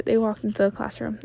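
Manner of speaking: ordinary delivery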